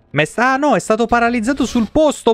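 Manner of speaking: usual speaking voice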